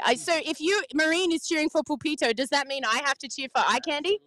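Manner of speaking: normal talking voice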